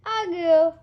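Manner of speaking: loud, high honk; bagpipe honk. Acted. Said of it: "bagpipe answers".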